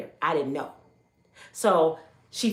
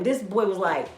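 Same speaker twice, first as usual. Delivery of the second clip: ordinary talk, nothing marked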